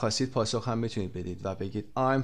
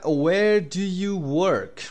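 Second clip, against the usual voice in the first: slow loud model question; 6 semitones higher, 5 dB louder, much slower than usual. Shown as a demonstration.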